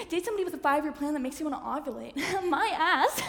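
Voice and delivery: laughing through the line. Real, not acted. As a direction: crack up mid-sentence. Bursts into laughter.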